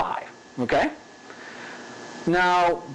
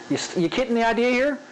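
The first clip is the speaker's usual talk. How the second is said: ordinary delivery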